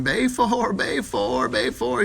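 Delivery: screaming. Acted. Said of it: "she starts screaming".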